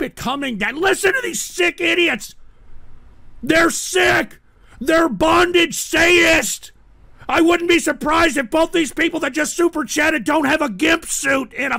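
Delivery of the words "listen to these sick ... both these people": angry rant. Real, not acted. Ranting at donors.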